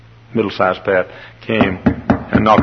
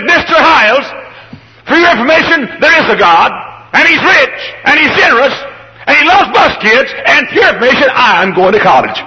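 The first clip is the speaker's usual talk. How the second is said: bossy little princess; 7 dB louder than usual. Retold or imitated. Indignant girl scolding pastor.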